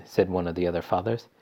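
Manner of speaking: usual speaking voice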